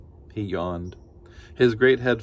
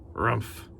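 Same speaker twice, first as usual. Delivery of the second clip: sleepy bear grunt; 4 dB quieter than usual. Acted. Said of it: "he growled".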